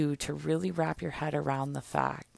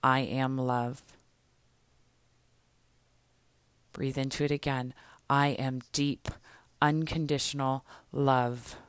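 affirmation. Shown as a demonstration. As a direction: declare each line as a self-affirmation. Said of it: "I want you to breathe into this right now".

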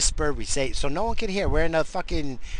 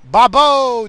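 high, loud, falling holler; mocking nickname shout; 8 semitones higher, 10 dB louder than usual. Telling a supervisor the coworker's nickname, mocking shout. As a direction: blare the nickname derisively.

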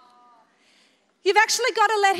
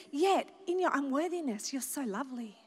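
much softer and lower, tender; tender loving reply; 5 semitones lower, 10 dB quieter than usual. Acted. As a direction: fade into a gentle, loving whisper of comfort. God answers her tenderly.

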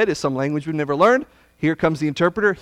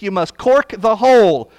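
ordinary talk

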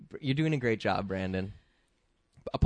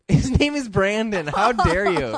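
high, loud, laughing indignation; laughing mock outrage; 11 semitones higher, 7 dB louder, slower than usual. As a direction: scold her through laughter.

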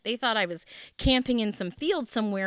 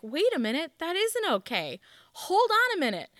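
rising high, incredulous; the penny drops, climbing higher; dawning realization; 8 semitones higher, 3 dB louder than usual. Retold or imitated. Realizing her friend is right, high and incredulous. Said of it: "it kinda sunk in with me".

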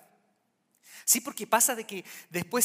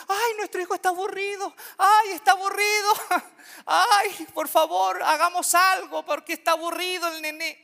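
much higher, louder mock parent voice; fussing parent; 10 semitones higher, 8 dB louder than usual. Preacher mocking parents who rush to entertain a bored child, hammed-up high fretting voice. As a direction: ham up a frantic mother fretting.

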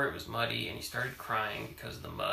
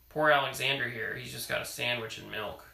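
ordinary delivery

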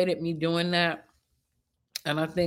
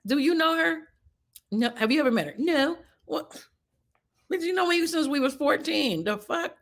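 incredulous; 9 semitones higher than usual. Retold or imitated. Arguing with homegirl, retold.

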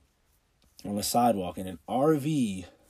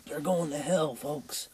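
high-pitched mock holler; retold yell; 7 semitones higher, slower than usual. Protester to couple walking in, mock yelling. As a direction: imitate a heckler calling out. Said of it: "So he yells".

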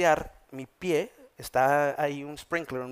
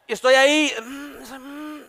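higher, held-in mock moans; stifled groans; 11 semitones higher, 6 dB louder, slower than usual. Pastor acts out suppressing pain on the table, high held moans. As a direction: swallow the pain macho-style.

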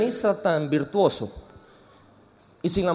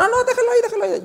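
much higher voice, brushing him off; mimicking the man on the phone; much faster than usual. Preacher imitates Miguel waving him off during a call, high-pitched. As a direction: squeak out "déjalo ahí" in a high voice.

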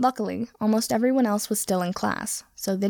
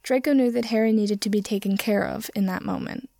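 usual speaking voice

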